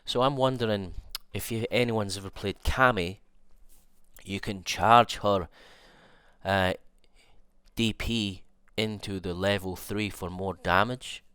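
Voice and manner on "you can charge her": normal talking voice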